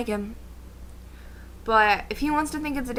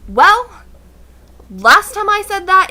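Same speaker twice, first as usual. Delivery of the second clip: loud, higher, dramatic; 8 semitones higher, 10 dB louder, slower than usual. Real, not acted. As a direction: announce it like a disaster. Dramatic outburst.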